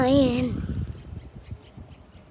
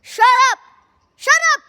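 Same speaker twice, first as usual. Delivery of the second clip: high shrieking shut up; 11 semitones higher, 4 dB louder, much faster than usual. Real, not acted. Kid shrieking at players to shut up, high and loud.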